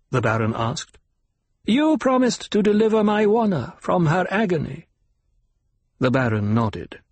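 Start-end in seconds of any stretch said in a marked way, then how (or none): 1.6-4.9 put-on old doctor voice. A narrator's reading.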